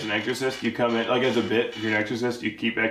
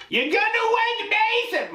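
yelling divorced mother. Acted. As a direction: scold in an over-the-top screech. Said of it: "Mom, you only yell now".

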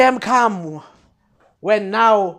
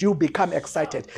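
normal talking voice

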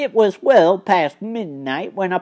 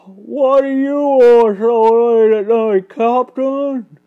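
louder, higher working man's voice; carpenter character voice; 4 semitones higher, 6 dB louder than usual. A narrator's reading. Carpenter woken by late callers, in his loud character voice.

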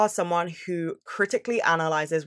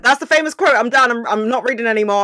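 high, loud, hurried declaration; re-enacted dramatic "I'm done"; acted-out outburst; 5 semitones higher, 7 dB louder, faster than usual. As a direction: blurt it out, loud and raised, as if quitting on the spot. Acting out her reaction on reaching quote.

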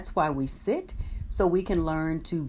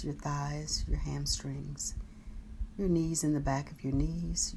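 guided meditation; 7 dB quieter than usual. Acted. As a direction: guide them gently through the body. Guided body scan meditation.

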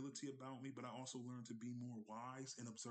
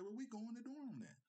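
much higher, matter-of-fact wife settling it; wife's voice; 12 semitones higher than usual. Retold or imitated. Wife deciding for her husband, higher settled voice. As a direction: pitch up as the wife and close the matter.

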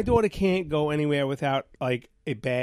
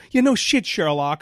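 sarcastic retort; 2 semitones higher, 6 dB louder than usual. Retold or imitated. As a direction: throw it back at the usher, mocking. I go like that to him.

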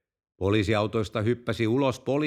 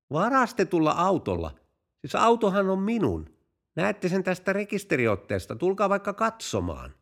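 high-pitched, puzzled innocence; innocent surprise; 8 semitones higher than usual. Acted. Driver denying the car is stolen, innocent surprise. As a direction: protest the accusation in a higher voice. Feigned innocence at the chief's accusation.

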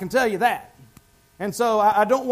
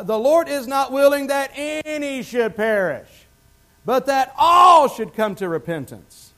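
slow, higher, swelling to a full shout; preached shout; 5 semitones higher, 6 dB louder, slower than usual. Real, not acted. Preacher repeating scripture to the congregation, building to a shout. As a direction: hammer the verse home, building loud.

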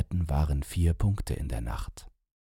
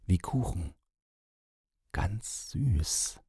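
softer, fading into a whisper on Ganz süß; a hushed, dreamy echo that ends in a whisper; hushed, savouring; 3 semitones higher, 4 dB quieter, slower than usual. A narrator's reading. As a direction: breathe it out, savouring the sweet smell. Man repeats, savouring the smell.

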